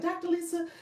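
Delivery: exaggerated high-pitched demand for attention, breaking into laughs; Diamond. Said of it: "Diamond's like".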